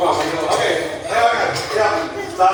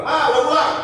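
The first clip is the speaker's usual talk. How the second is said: raised-pitch call on coming home; 7 semitones higher, 4 dB louder than usual. Shown as a demonstration. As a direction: call to mum on arriving home. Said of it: "pasti gue bilang". (memanggil ibu saat pulang)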